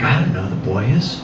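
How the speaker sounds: low, soft, clueless mumble; hushed sheepish reply. Retold or imitated. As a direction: murmur it like a guilty dad. Clueless dad answering wife.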